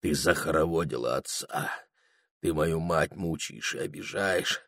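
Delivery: low, soft reproach; faint sleep murmur. A narrator's reading. Sleeping Прохор reproaching the witch, faint murmur. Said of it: "еле шептали во сне его губы".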